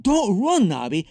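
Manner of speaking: high, loud plea at the start; pleading cry, easing on the name; urgent plea. A narrator's reading. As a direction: plead loudly with a runaway dog. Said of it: "Nick pleaded".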